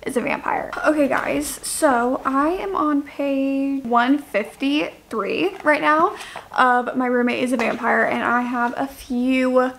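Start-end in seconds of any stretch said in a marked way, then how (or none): 1.5-9.7 unhurried drowsy drawl; talking slow. Real, not acted. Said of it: "Why am I talking, like, so slow right now?".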